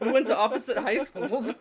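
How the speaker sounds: loud, high punchline through laughter; real amused laughter in the voice; laughing. Real, not acted. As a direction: laugh while delivering the punchline. Laughing over the story's punchline.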